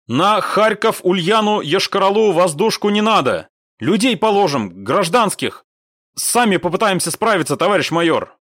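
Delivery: high, loud radio plea; urgent objection. A narrator's reading. Squad leader refusing air support over civilians, urgently. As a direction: argue urgently against the strike.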